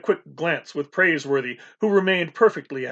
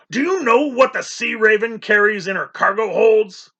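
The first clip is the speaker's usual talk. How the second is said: loud, higher bellow; 6 semitones higher, 5 dB louder than usual. A narrator's reading. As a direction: bellow it across the cabin. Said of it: "Captain Swain bellowed".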